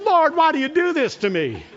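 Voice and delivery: high, loud mock lament with a laugh; half-laughing protest at heaven; laughing complaint. Preacher retelling his laughing complaint to the Lord, high and loud. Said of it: "And I said".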